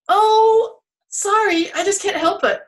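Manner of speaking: high, loud mock apology; giggly sorry from the joking tree; sheepish tree.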